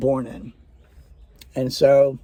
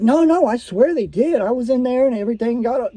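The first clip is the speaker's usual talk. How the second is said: higher-pitched, urgent retold objection; insistent protest; 6 semitones higher than usual. Patient arguing back with nurse about morphine, insistent and higher. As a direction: protest as the patient who won't be disbelieved.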